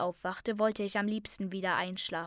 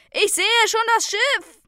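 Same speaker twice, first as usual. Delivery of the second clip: very high, loud lookout's cry; shouted; 13 semitones higher, 11 dB louder than usual. A narrator's reading. Zayden spotting the ship while fleeing, high shout. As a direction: shout the sighting to the others. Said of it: "schrie Zayden".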